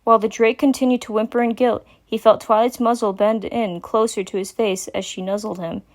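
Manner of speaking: ordinary delivery, nothing marked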